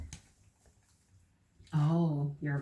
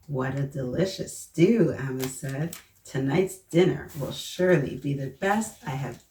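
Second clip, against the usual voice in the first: lively, louder picture-book storytelling voice; reading to children; 6 dB louder than usual. Acted. Teacher reading a picture book aloud to the children, lively storyteller voice. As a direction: read it out big and bright for the little ones.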